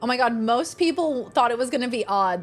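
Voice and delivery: normal talking voice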